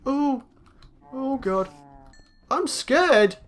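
ordinary talk

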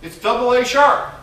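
high, loud feigned innocence; mock nonchalant comeback. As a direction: play it loudly as if it were obvious. Acted comeback to baffled pianist.

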